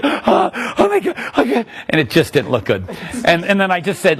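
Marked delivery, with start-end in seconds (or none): 0.0-1.6 high, breathless imitation of panic; mock panic. Retelling the Starbucks customer's panic, high. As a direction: play the flustered lady gasping. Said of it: "she's freaking out".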